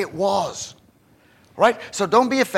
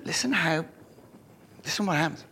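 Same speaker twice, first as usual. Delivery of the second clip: ordinary talk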